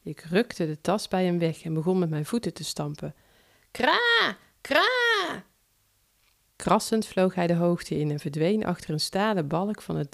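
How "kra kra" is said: high, harsh cawing like a crow. Acted. Narrator voicing the crow as it flies off, high caw. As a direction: caw sharply like a startled crow.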